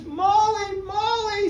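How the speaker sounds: high, loud, overjoyed calling; excited calling. Acted. Husband bursting in to tell his wife, overjoyed calling. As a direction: call her name with delight. Mike runs home overjoyed.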